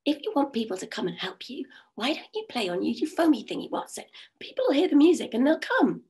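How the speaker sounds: whispery little pixie voice; breathy sprite whisper, then a high lift. Acted. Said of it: "said the pixie".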